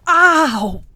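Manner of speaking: loud, high cry of pain; pained yelp. Acted. Patient whose shoulder is set early, sharp pained cry. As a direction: yelp as the joint is wrenched. Shoulder popped back mid countdown.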